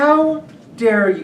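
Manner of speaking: high, slow, laughing mock offence; slow theatrical how-dare-you, half laughing; mock outrage. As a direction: play it as huge fake indignation, grinning.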